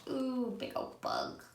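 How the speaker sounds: plain reading voice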